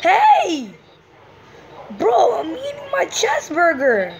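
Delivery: high, loud outraged yell; indignant shout. Real, not acted. Gamer yells at player who took his cheeseburger. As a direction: protest like your food was stolen.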